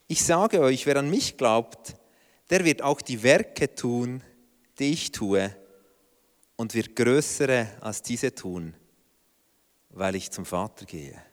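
low, slow and hushed, like sacred words; quoting Jesus with quiet gravity; solemn. Acted.

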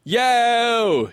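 high, long-held rock cry; imitated singer's yell. Host echoes the singer after the line, mock rock yell. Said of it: "He goes".

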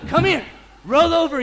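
loud bark-like call to a dog; dog command. Retold or imitated. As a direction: order the dog around. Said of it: "commanding a dog".

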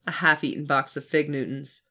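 usual speaking voice

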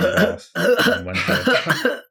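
high, slow pitiful sick voice. Shown as a demonstration. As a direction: croak it out like you're ill. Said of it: "practice my I'm sick voice".

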